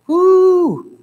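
loud, high-pitched "whoo"; a drawn-out, high exclamation at a loud memory; high whoop. Real, not acted. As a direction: let out a sudden high whoop. Reacting to memory of screaming hogs.

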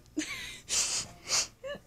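very high-pitched squeal of laughter. Real, not acted. Reader breaking off from the story, squealing laugh.

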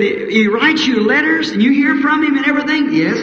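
high incredulous question. Acted. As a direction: ask it in high amazement. County man incredulous.

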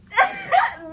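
shrieking laughter; loud high laugh. Real, not acted. Vlogger shrieking with laughter at her biscuit joke.

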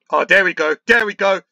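loud, rising cheer; excited shout. Real, not acted. Gamer cheering his team's attack, excited shout. Team gets a chance.

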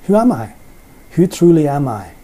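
usual speaking voice

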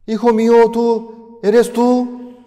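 very high, loud disbelieving shout; overjoyed father. Acted. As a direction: shout in stunned joy. Father hears his son.

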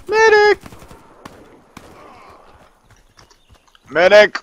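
high, loud call for a medic; man down shout. Pretend. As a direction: yell for the medic. Calling for medic in game.

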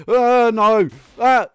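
loud, high cry of protest; alarmed cry. Real, not acted. Player losing a life in the game, alarmed shout. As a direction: cry out as it all goes wrong.

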